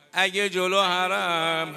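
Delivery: loud, slow, stretched-out words; lamenting preacher. Real, not acted. Preacher challenges listener about the shrine, loud lament. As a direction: draw it out as a mournful call.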